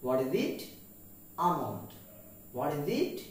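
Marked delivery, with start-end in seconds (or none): 1.4-1.9 Almond with a silent L; correct pronunciation. Shown as a demonstration. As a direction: say Almond as "AH-mond". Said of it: "the pronunciation of this word is almond".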